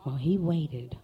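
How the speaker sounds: ordinary delivery, nothing marked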